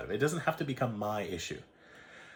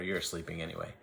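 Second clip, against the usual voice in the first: ordinary talk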